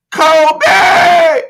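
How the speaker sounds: loud, high shout across a room; screamed name. Retold or imitated. Co-worker at the back of a staff meeting, screaming out. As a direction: yell the name out at full voice, imitating the caller. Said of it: "And he screams out".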